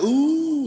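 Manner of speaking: high, loud drawn-out "ooh"; crowd "ooh". Retold or imitated. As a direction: imitate a scandalized crowd's ooh. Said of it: "The crowd's like".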